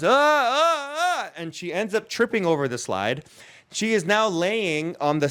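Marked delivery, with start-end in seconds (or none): 0.0-1.3 high startled cries, ah ah ah; panicked yelps. Retold or imitated. Little girl panics at a bee, high frightened yelps. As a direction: squeal like a scared kid swatting at a bee. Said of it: "she goes".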